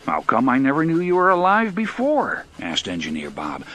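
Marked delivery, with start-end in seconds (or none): 0.1-2.4 loud, higher, eager wonder; amazed questioning. A narrator's reading. Engineer to his newly talking train, amazed. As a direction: ask in raised, astonished voice.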